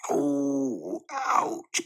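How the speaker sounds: breathy little ouch of hurt; child's cry of pain. A narrator's reading. Kipper yelps as Dad combs his hair. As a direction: yelp as the comb pulls. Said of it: "cried Kipper".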